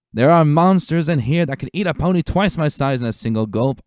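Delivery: rally the troops with a firm officer's call; commanding lieutenant. Acted. Lieutenant ordering stallions to join the forest search, loud and commanding.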